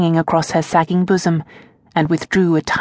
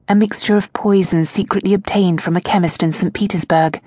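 ordinary delivery

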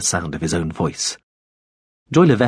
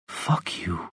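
whispered, much softer curse; very quietly; 9 dB quieter than usual. Acted. Lededja cursing Veppers from her hiding place, whispered. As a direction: breathe the curse out under your breath. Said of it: "very, very quietly".

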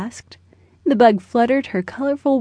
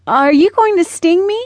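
put-on high, loud, mildly worried child's question; hesitant; 8 semitones higher, 5 dB louder than usual. A narrator's reading. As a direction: ask uneasily, lifting the pitch right up. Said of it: "said Austin hesitantly".

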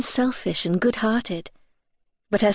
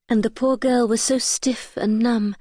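usual speaking voice

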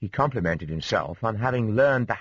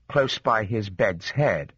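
ordinary delivery

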